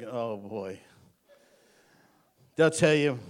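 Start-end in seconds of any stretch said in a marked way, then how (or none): 0.0-0.8 low, soft groan of "here we go"; mock dread. Preacher acting his reaction to someone's two cents, mock dread. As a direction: sink into a weary, quiet aside.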